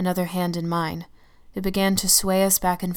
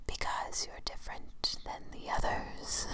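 slow, eerie unvoiced whisper; shadow whisper; 11 dB quieter, slower than usual. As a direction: breathe it like a ghost. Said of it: "I could hear its voice".

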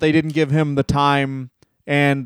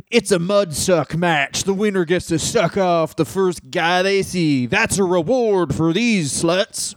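mock sleazy booker voice; 3 semitones higher, slower than usual. Mocking imagined wrestling booker.

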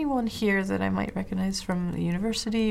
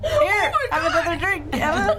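laughing mock hostess; 9 semitones higher, 3 dB louder than usual. Mocking the NPC, laughing.

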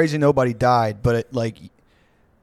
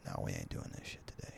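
whisper; 23 dB quieter than usual. Retold or imitated. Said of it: "he just was like".

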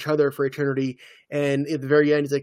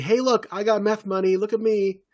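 higher-pitched mock boast; mock showing off; 6 semitones higher than usual. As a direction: show off in a put-on way, pitched up. Voicing an imagined show-off.

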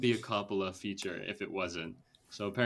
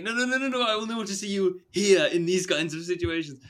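high, loud imitated British accent; 8 semitones higher, 5 dB louder, faster than usual. Retelling the actor backtracking to the interviewer, in a high British accent. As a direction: gush it out in a pitched-up British voice. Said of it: "I'm doing the British accent".

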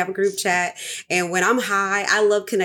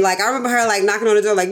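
normal talking voice

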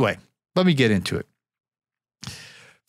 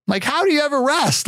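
much higher, incredulous question; exasperated disbelief; 12 semitones higher than usual. Real, not acted. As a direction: marvel at how she ever manages. Marvelling at sister's three toddlers.

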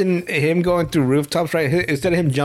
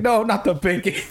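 mock plea; 6 semitones higher than usual. Acted plea of threatened victim.

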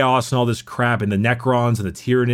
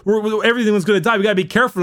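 frantic warning; 7 semitones higher, faster than usual. Retold or imitated. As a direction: blurt the warning in a panic. Ship machine warns the crew.

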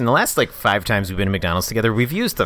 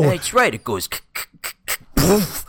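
hammed-up cartoon mobster showing off; cartoon gangster; 7 semitones higher than usual. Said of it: "why do we always sound like cartoon gangsters".

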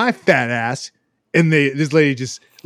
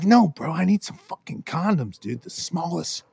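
deadpan request; 4 dB quieter than usual. Retold or imitated. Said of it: "But I'm like".

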